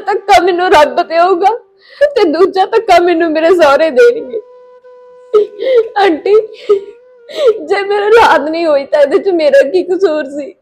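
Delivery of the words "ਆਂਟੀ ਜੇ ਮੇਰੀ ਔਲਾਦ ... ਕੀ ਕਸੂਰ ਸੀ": tearful plea of innocence; crying, hurt and helpless; sobbing protest. Acted. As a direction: plead through sobs.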